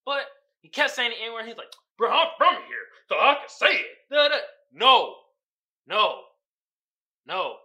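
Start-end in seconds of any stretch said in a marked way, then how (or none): 2.0-4.4 loud, high swaggering quote of the guy; imitating a guy insisting he's from here; mock tough guy. Friend retelling the guy's claim, loud mock swagger. 4.7-7.6 loud flat refusal, fading each time; emphatic no. Real, not acted. Speaker refusing the guy, loud emphatic no.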